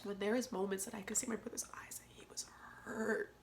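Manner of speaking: soft unvoiced whisper. Real, not acted. Whispering about seeing her brother hurt. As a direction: whisper it, close to tears.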